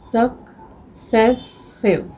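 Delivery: Successive drawn out very slowly, the middle सेस lingered on; slow sounding out. Shown as a demonstration. Teacher slowly sounding out successive to show the middle sound. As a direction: spell successive out slowly for the class. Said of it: "सेस आ रहा है middle में".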